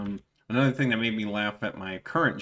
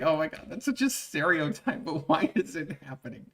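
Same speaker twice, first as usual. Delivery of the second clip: a hint of a higher Valley Girl; Valley Girl, dismayed; 4 semitones higher, 4 dB quieter than usual. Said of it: "It's like".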